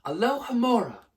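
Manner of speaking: usual speaking voice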